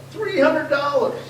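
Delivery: high, drawn-out price announcement; high price quote. Retold or imitated. Quoting the seller's high price.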